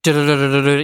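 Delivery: quick run of du-du sounds like a phone keypad; mock machine beeps; imitated beeping. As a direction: rattle off the du-du noise like a keypad tone. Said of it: "it goes like".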